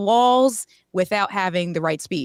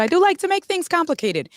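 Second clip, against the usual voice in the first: much higher playful repeat; 9 semitones higher than usual. Shown as a demonstration. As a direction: say it again with a high teasing lift.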